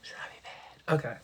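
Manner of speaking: soft unvoiced whisper; embarrassed whisper. Real, not acted. Vlogger whispers to viewers, too embarrassed to say it aloud. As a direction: whisper it like a naughty secret. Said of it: "I'm embarrassed now".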